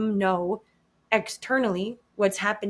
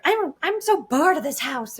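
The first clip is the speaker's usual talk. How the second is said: hammed-up bored kid, squeaky cartoon-mouse pitch; spicy little daughter; 8 semitones higher, 4 dB louder than usual. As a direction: imitate a child's high, whiny complaint. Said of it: "she doesn't talk like Mickey Mouse, Minnie Mouse".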